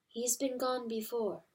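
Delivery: soft, hushed mother hen; gentle hen. A narrator's reading. Hen calming grieving mice, soft and gentle. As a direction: soothe them quietly. Pertolote voiced softly.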